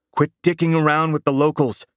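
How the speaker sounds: high-pitched, mildly booming scolding; booming reprimand. A narrator's reading. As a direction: scold over the radio, pitched well up. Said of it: "Doc's voice boomed".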